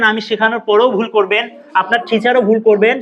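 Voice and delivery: ordinary talk, nothing marked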